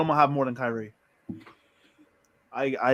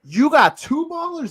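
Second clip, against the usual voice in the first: hyped emphasis; 12 semitones higher, 6 dB louder than usual. Real, not acted. Emphatic hype point.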